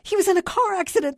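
high, loud, rushed cry; frantic, distraught outburst; weeping wail. A narrator's reading. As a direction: blurt it out tearfully. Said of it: "the voice whipped".

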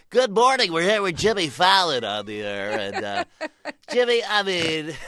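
high, loud, hyped radio DJ voice; brash morning radio DJ goading a guest; morning zoo DJ. Retold or imitated. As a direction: blast it out like a shock-jock host. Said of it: "I'd have to go on radio shows".

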